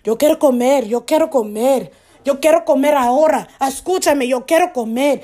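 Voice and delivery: hammed-up Spanish, pretending to be a foreigner; fake Spanish speaker. Pretending to be Spanish for the cops, mock accent. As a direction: play dumb in mock Spanish. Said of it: "all of that role playing".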